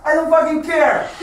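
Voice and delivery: high, angry defiance; heated refusal to care; defiant outburst. Acted. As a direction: throw it out hard and high.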